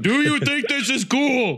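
booming slow robot yell; loud harsh voice. Retold or imitated. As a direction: bellow it slowly at him. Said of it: "stop yelling".

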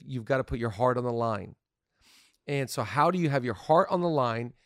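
normal talking voice

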